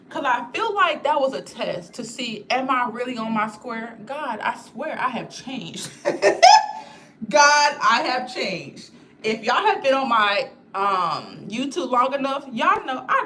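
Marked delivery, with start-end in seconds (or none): 5.9-6.8 big squealing laugh, much higher and louder; high delighted laugh. Real, not acted. Proud she didn't cuss anyone out, squealing laugh. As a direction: burst out laughing with pride.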